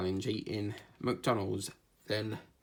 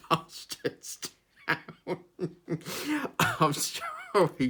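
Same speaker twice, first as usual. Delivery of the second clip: helpless real laughter through the words; laughing; 7 semitones higher, slower than usual. Real, not acted. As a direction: try to say it and crack up, high and breaking. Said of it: "say that without laughing".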